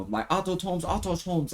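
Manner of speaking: autotones; teacher's mispronunciation. Retold or imitated. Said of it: "didn't even know how to say auto".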